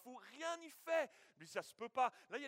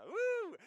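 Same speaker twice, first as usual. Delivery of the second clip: mock whoop; 14 semitones higher, 10 dB louder than usual. Joyful exclamation at car rising.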